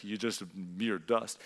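low, slow mild put-down; demeaning. Acted. Preacher showing what God does not mean, mildly demeaning. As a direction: draw it out quietly, belittling. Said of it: "a demeaning, like".

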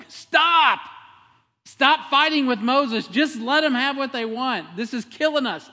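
high, loud, pleading shout; desperate shout. Retold or imitated. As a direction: servants begging Pharaoh to stop, yelling high. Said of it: "his own servants were like".

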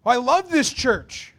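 high, loud, drawn-out mock devotion; gushing. Churchgoer professing love for church, hammed-up gushing. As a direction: gush it slowly and loudly.

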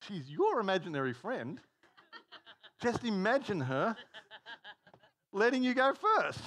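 dad laughing at his daughter's imaginary friend; laughing disbelief. Retold or imitated. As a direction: plead with her while cracking up. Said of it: "I'm like".

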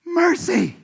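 high, loud exclaimed Mercy; imitating Nina's Mercy. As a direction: cry out Mercy like a Southern big sister. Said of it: "she used to say".

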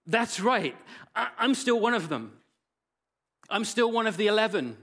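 high, loud, delighted; joyful relief. Acted. Peter hearing he is still a disciple, high joyful outburst. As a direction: burst out with joy.

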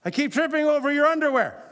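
loud, high-pitched mock nagging; hammed-up complaint of an exasperated spouse; mock spousal complaint. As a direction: raise pitch and volume, imitating a nagging spouse. Acted spouse complaint, for laughs.

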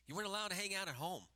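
rising preaching. Acted. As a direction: preach the outcast's loneliness home.

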